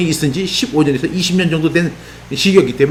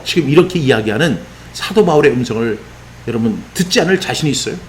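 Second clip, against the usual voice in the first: normal talking voice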